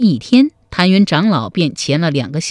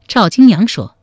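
usual speaking voice

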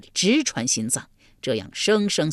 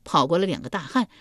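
ordinary delivery, nothing marked

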